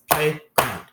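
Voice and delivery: "Playground" in two separate, punched beats; pounded-out syllables. Shown as a demonstration. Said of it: "pound out its syllables".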